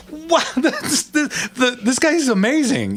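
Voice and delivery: put-on the beaten wrestler can't believe the old man; high, wide-eyed wow with a laugh; amazed kid. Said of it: "It was like".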